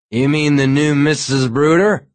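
put-on loud, slurry drunk man. A narrator's reading. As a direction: sound a bit drunk and loud. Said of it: "The man was obviously drunk".

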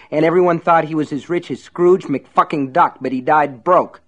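heated, fast ranting; angry rant. Acted. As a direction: spit it out in rage. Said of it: "by his rage".

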